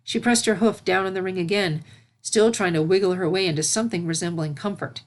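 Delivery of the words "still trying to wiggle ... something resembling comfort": usual speaking voice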